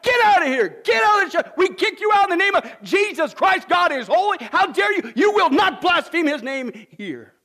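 high, loud shout throwing him out; pretend shout. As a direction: shout the heretic out of the church. Imagined church expelling blasphemer.

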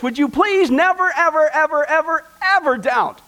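pastor pleads with the congregation, leaning hard on ever ever ever ever; stress on ever. Real, not acted. Pleading, insistent build on the repeated ever.

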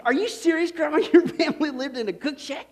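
can't believe what grandma just said; retold kid's astonished question; amazed disbelief. Young self asking grandma, amazed.

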